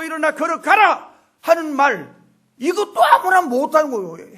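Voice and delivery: high, loud pulpit exhortation; forceful preaching. Real, not acted. Pastor insisting only the Lord can say this, fervent.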